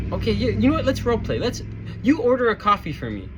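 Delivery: high, bright mock manager voice pushing the role play; imitating an overly eager corporate lady.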